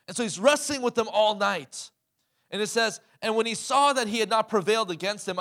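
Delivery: loud, high preaching; full-force preaching. Acted. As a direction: preach it out with fire.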